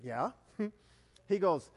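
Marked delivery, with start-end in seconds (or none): none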